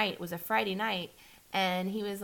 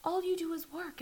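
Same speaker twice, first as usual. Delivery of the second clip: higher mimicking ex's complaint; mock him whining; 10 semitones higher, 3 dB quieter than usual. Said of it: "He would say".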